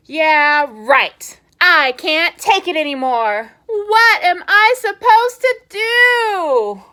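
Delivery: a pigeon at his wits' end; frustrated pigeon. Acted. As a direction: pigeon losing it over the pesky duckling. Pigeon despairs.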